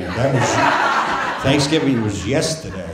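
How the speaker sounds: breaking into laughter at the absurdity; laughing. Real, not acted. Telling father the obvious, laughing.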